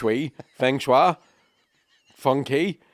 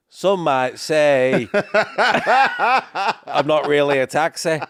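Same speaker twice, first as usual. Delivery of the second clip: put-on drawn-out, louder English cabbie accent; mock English accent; 4 semitones higher, 5 dB louder, much slower than usual. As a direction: stretch it out as a hammed-up English cabbie. Continues mock English taxi driver.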